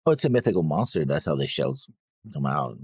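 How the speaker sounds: usual speaking voice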